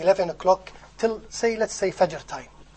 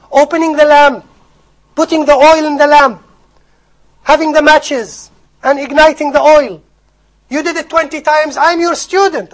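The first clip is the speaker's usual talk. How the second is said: high, loud, pleading student voice; earnest plea; 9 semitones higher, 12 dB louder than usual. Retold or imitated. Student pleads with his teacher for being left asleep, loud and pressing. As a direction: urge the teacher, voice raised and pressing. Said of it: "Warraqa says to Imam Bukhari".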